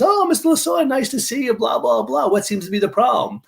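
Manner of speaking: high, gushing mock-official voice; fawning supervisor. Supervisor greeting a celebrity held up at security, fawning.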